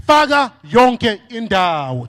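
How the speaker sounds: slow, loud chant with faka stressed; slow loud slogan. Shown as a demonstration. Speaker chants the slogan for the crowd to echo, slow and loud. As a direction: drag out faka yonke indawo so the crowd can follow. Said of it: "Say it after me".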